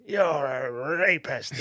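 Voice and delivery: drawn-out, hammed-up accusation; wrestling boss impression. Podcaster joking as a wrestling boss, slow put-on voice. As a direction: stretch it slowly in a put-on villain voice. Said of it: "It's quite a decent impression".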